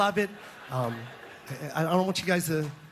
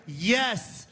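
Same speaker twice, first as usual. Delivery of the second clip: normal talking voice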